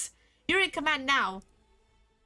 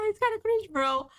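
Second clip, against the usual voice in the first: much higher, laughing mock repeat; 8 semitones higher than usual. Shown as a demonstration. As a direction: squeak it out teasingly.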